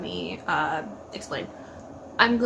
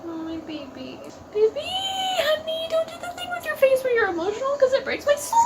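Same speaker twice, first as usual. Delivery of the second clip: high, teary pleading to the screen; tearful plea. Real, not acted. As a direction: beg him not to cry, voice breaking. Pleading to emotional character.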